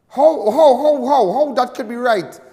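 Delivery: loud, high incredulous how how how; a radio host erupting in outrage; outraged disbelief. Real, not acted.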